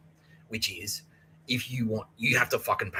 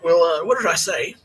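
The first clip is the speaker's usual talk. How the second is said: high, pointed questioning building louder; stern telling-off; 9 semitones higher, 10 dB louder than usual. Retold or imitated. Housemaster confronting drunk student about the book, scolding.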